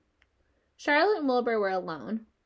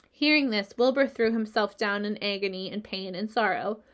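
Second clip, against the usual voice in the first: plain reading voice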